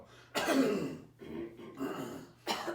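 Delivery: loud chesty cough; clearing congestion with a hard cough; real cough. Real, not acted. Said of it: "I have some that I need to go".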